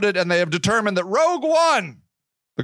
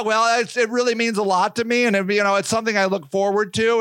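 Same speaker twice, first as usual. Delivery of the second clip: ordinary talk, nothing marked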